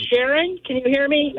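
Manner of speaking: normal talking voice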